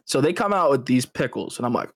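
normal talking voice

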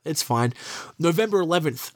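ordinary talk

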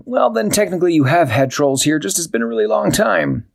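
high, worried cat voice; puzzled and upset; dismayed Barnaby. Acted. Barnaby in the empty room, high dismayed voice. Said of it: "Then Barnaby said".

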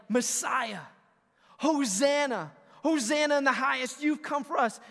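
loud, high mock crowd cheer; hammed-up joyful crowd shout; crowd yelling. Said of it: "They're no longer yelling".

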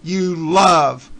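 loud, heated final scolding; angry loud rant. Real, not acted. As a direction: lay into him loudly. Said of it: "angry closing rant".

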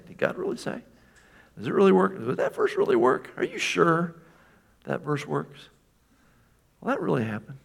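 Satan taunting with doubt; mocking Satan voice. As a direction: sneer the doubts like the devil whispering. Preacher voicing Satan's taunting questions.

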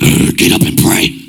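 mock-scary growled command. Preacher mocking a frightening Holy Spirit, scary voice. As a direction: growl it like a spook giving orders. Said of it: "he's not gonna scare you like".

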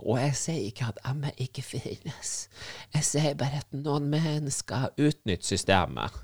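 slow whispered aside. Real, not acted. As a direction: whisper the disclaimer slowly.

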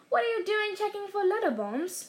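plain reading voice

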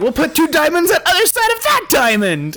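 very high, loud hammed-up salesman; mock salesman pitch. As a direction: imitate an absurd sales pitch in a high yell. Salesman's upgrade pitch mockingly acted out.

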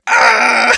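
short high loud yelp; mock panicked yelp. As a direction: yelp as if the engine's screaming. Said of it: "internally going".

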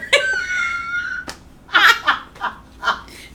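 high shrieking laugh; loud burst of laughter. Real, not acted. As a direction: crack up loudly.

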